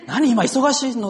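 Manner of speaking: mock-sharp wife, high and loud; sharp, strong tone. Busy wife answering husband's repeated calls, in an exaggerated strong tone. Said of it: "強い口調に聞こえた".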